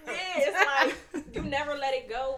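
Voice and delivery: loud, high, laughing delivery; talking through laughter. Real, not acted. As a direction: push the point out while laughing. Cracked up after "Triggered.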